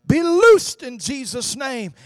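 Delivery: high, loud, slowing on the last words; commanding shout. Retold or imitated. Evangelist casting out a deaf spirit, shouting. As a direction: cast the spirit out with full force. Final healing command in Jesus' name.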